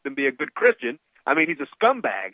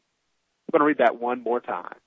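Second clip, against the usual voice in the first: ordinary delivery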